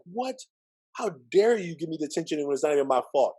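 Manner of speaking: high, incredulous outrage; outraged protest. Retold or imitated. Boy protesting his unfair detention, outraged. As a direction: demand how she dares, indignant.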